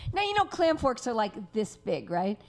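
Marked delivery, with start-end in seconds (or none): none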